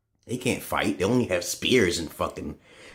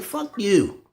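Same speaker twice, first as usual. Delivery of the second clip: high retold outburst at the cracker; imitating his angry comeback; mock angry retort; 13 semitones higher than usual. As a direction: fling it at him, half-joking. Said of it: "I'm like".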